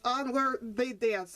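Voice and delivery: usual speaking voice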